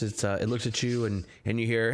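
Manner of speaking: ordinary delivery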